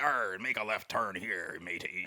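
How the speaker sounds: slow hammed-up pirate drawl. GPS pirate voice giving directions, slow and hammed-up. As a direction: growl it like a cartoon pirate. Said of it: "you could have a pirate respond".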